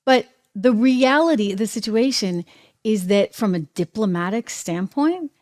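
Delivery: usual speaking voice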